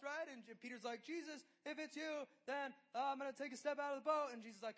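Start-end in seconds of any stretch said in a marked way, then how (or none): 1.0-4.3 eager Peter. Retold or imitated. Peter bold on the water.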